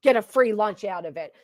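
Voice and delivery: ordinary talk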